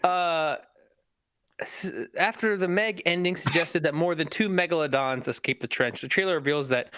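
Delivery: ordinary talk, nothing marked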